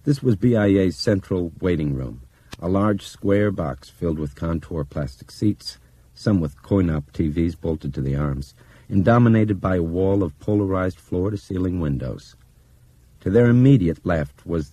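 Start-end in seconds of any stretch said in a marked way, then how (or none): none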